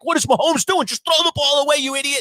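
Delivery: high, mock frustrated yell; retold scream. Yelling at Mahomes on TV in his living room. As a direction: imitate yelling at the TV. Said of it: "I'm screaming".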